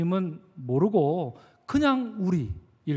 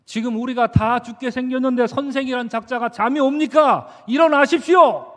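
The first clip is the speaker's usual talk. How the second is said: acted irritated rebuke building to a loud shout; angry confrontation; 3 semitones higher, 4 dB louder than usual. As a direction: berate the sleeper, ending in a raised, shouted order. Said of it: "이렇게 다그쳐 물었던 것이겠지요". (짜증과 분노로 다그치는 연기)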